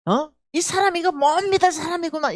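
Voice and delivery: high, sneering scorn; mocking accuser. Man sneering at the indebted woman, high and scornful. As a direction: jeer at her as a cheat.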